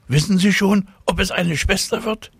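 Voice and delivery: put-on high-pitched little girl voice; eager child's question. A narrator's reading. Tina asking the caller about the baby, high child voice. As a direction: ask it high and keenly.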